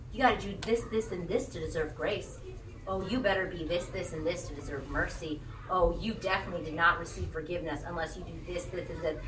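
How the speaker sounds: a quick, quiet parody of a nagging legalist; mock rule-maker. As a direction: rattle off the rules like a smug legalist.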